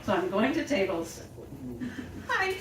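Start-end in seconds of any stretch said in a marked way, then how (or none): 2.3-2.6 mock-sobbing greeting to customers; hammed-up weepy hello; crying. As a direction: try to greet a table through tears. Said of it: "cannot stop crying".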